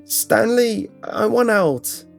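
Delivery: high, drawn-out shout for release; acted panicked cry, pitched far up; desperate yell. A narrator's reading. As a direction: yell to be let out, stretching the words. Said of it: "much louder, I yelled".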